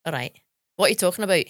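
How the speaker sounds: put-on Scottish accent, lifting in pitch; Scottish accent demo on request. Shown as a demonstration. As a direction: do a chatty Scots voice. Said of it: "Go as your Scots".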